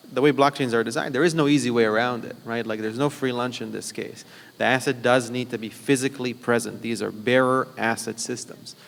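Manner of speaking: normal talking voice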